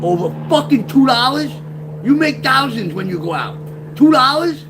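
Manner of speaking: very high and loud disbelief; can't believe it's over two dollars; incredulous shout. Retold or imitated. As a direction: friend mocking the tiny sum in shock. Bobby's disbelief, retold.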